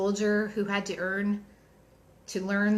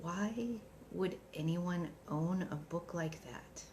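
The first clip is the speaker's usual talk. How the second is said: dry, quiet and slow; deadpan, softly unimpressed; droll; 11 dB quieter, slower than usual. Retold or imitated. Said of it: "very droll response".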